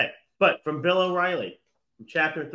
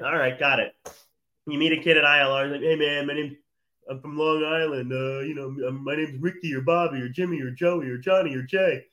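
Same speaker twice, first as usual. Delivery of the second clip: mock Long Island guy, loose and cocky; imitating a cocky New York kid; Long Island bro voice; 4 dB louder than usual. Teasing Cornell students by imitating a Long Island kid. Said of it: "I don't know why they're talking like that".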